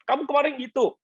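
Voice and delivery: high, mock-whiny wife voice; a hammed-up nagging wife; mock wife complaint. Pastor voicing wife's complaint.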